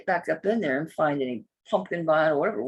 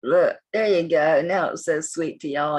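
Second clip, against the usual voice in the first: usual speaking voice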